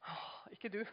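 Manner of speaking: unvoiced, hushed; a breathy aside with a soft laugh; whispered aside. Real, not acted. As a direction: drop to a whisper. Whispered correction.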